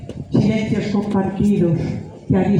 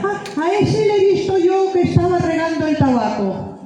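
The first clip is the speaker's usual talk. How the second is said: a higher-pitched neighbour piping up; lightly acted village gossip, pitched up; chiming-in neighbour; 8 semitones higher than usual. Neighbour telling where the missing person was, chiming in. Neighbour quickly reports seeing someone.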